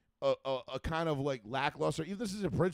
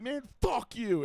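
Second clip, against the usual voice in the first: mock angry retort; 9 semitones higher, slower than usual. Teammate snaps back in retelling.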